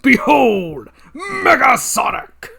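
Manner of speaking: booming, drawn-out reveal; grand proclamation. Acted. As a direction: announce it to the world.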